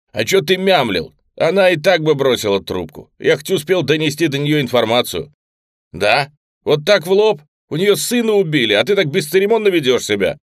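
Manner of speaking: loud, high heated retort; heated argument. Acted. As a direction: argue back hotly. Heated quarrel between friends. (на повышенных тонах)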